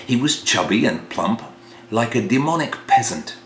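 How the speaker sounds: ordinary delivery, nothing marked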